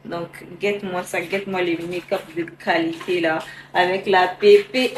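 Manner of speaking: low, deep-voiced presenter. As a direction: speak way down low and proud. Wig artist voice presenting look.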